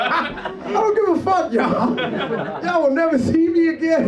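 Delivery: high-pitched, loud defiant outburst; defiant yelling. Real, not acted. Judge defying the laughing room, defiant yelling.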